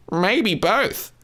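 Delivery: high quick stagehand quip. Acted. Second stagehand joking to Bert, high and quick.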